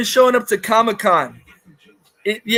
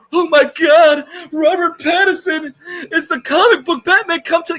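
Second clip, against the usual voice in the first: mocking gushing critic; 6 semitones higher than usual. Ranting vlogger mimics a critic praising Pattinson, in a high mocking gush. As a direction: parody her fangirl squeal.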